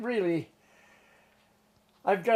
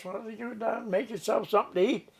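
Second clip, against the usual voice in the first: high, quick mock scolding; retold skipper's retort; 8 semitones higher than usual. Skipper answers a hungry deckhand, mock scolding. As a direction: throw it back as a brisk order.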